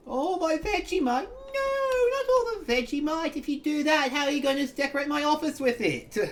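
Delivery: high whiny fake crying; mock wailing. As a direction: wail like a spoiled child. Mock despair over lost Vegemite.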